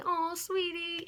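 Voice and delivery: high, slow sugary coo; cooing moms. Retold or imitated. Both moms to daughter in labor, high slow coo.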